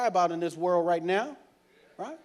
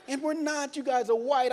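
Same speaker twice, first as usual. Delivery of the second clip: normal talking voice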